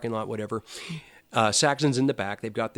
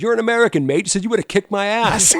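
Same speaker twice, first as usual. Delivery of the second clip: put-on English accent, higher and louder, laughing at the end; British accent; 10 semitones higher, 8 dB louder than usual. Biff teasing the American fan, in an English accent. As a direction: do Biff's cheeky English accent. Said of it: "with that accent".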